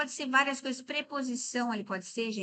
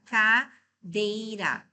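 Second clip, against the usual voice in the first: Cadeira said slowly, split as ca-dei-ra; syllable by syllable, slow and clear; separated into syllables; 5 dB louder, much slower than usual. Shown as a demonstration. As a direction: spell out the syllables for students. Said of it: "Vamos separar".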